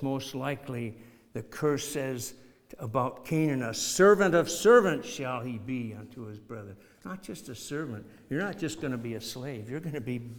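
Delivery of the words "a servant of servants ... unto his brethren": emphatic scripture reading. Acted. Preacher reading the curse.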